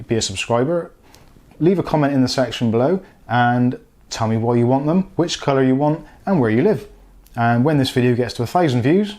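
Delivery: usual speaking voice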